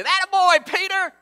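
cheering Peter on with a slap on the back; hearty cheer. Pretend. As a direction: cheer him on like a proud coach. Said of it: "slap him on the back and say".

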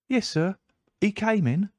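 put-on high, eager young servant boy; a breathless boy at the door; page boy voice. A narrator's reading. Page boy answering Holmes.

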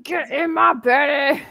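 slow, hammed-up Scottish brogue; mock Scottish accent. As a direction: do a cartoonish Scottish ogre. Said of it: "how to learn Scottish".

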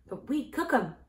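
mildly triumphant comeback. A narrator's reading. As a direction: score a point in the argument. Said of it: "answered Dorothy triumphantly".

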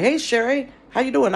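high, loud friendly hello; cheerful greeting. Retold or imitated. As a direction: greet her brightly. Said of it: "was like".

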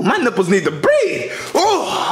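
normal talking voice

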